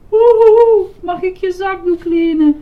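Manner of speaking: loud, high hammed-up sob; sobbing. A narrator's reading. Grover asks to borrow a handkerchief, sobbing. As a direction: wail the request through mock tears. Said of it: "snikte Grover".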